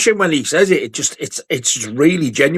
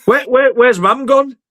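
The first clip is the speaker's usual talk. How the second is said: mock question; 11 semitones higher, 3 dB louder than usual. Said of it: "Like the butler".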